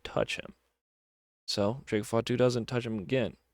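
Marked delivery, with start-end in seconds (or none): 0.0-0.5 a hushed, breathy threat; whispered warning. Retold or imitated. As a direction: drop to a whisper to warn him off. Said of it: "He's like".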